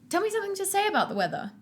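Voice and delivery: high, fast panicky pleading; panicked plea. Acted. Nervous groom begging his sister for words, high panicky plea. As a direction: blurt it out in a rising panic.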